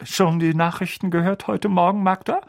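lightly voiced uncle. Uncle changing the subject to his sister. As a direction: steer away fast.

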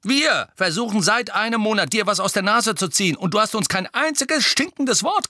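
high, loud and fast protest; calling out. A narrator's reading. Twin loudly reproaching Harry at the table. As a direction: shout the grievance at Harry. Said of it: "rief George".

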